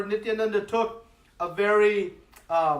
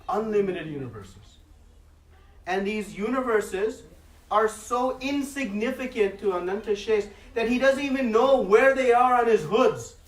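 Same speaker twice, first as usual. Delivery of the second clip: normal talking voice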